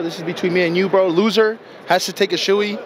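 normal talking voice

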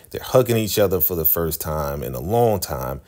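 normal talking voice

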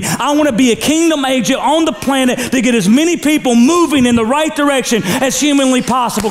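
high, loud preaching declaration; declared out loud. Shown as a demonstration. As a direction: proclaim it like a vow to the church. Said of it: "you need to say".

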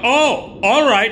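loud, high mock acceptance; Joe Donatello. Buddy reacts to the director's refusal, loud high impression. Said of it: "Joe's like".

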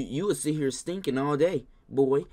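ordinary talk

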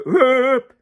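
high loud whoop; sharp high whoop; resonant whoop. Shown as a demonstration. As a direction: whoop high to ring the room. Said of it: "and one will go".

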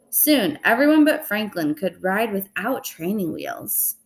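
ordinary delivery

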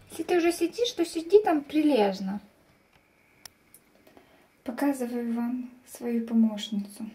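ordinary talk, nothing marked

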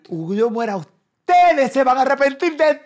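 high, loud, drawn-out wail; imitating dramatic mom. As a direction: build into a hammed-up maternal outburst. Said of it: "mi mamá bien dramática". (madre melodramática imitada a gritos)

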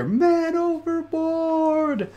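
high, drawn-out cry from far away; a faint, long, high cry of man overboard; distant shout. A narrator's reading. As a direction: call it out like a sailor far across the water. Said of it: "barely out in the distance".

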